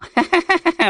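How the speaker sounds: big booming ghost laugh, high; hearty ha-ha laugh. Acted. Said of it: "哈哈大笑".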